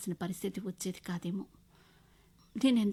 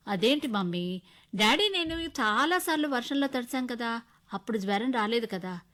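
put-on raised, eager little girl; a child protesting to mom, pitched up; child's voice; 4 semitones higher, 7 dB louder than usual. A narrator's reading. Child argues with her mother. (చిన్నపిల్ల గొంతుతో, ఉత్సాహంగా)

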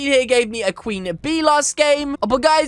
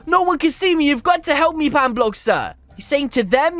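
ordinary talk

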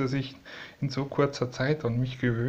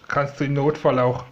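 ordinary talk

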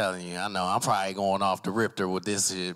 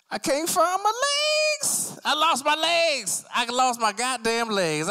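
very high, stretched-out wail; hammed-up panic; 4 dB louder, slower than usual. Stoned man cannot find his legs, hammed-up panic. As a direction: wail it high like a comic catastrophe.

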